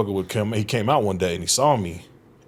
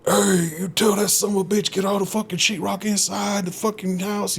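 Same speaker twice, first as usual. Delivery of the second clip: much higher bossy foreman's orders; imitating the angry foreman; 11 semitones higher than usual. Foreman ordering sheetrock inside before rain, higher bossy mock yell. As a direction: bark orders like a rushed foreman. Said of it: "So he was like".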